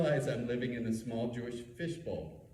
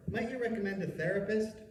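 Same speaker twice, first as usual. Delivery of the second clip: casual; 8 semitones higher than usual. Retold or imitated. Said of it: "I say casually".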